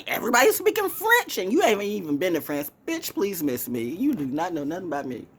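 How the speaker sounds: normal talking voice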